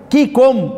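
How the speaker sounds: كيكم with a firm, stopped k; kaf demo. Shown as a demonstration. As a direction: hold the k in كيكم tight and strong. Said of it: "راعي الشدة". (نطق الكاف في كيكم بشدة)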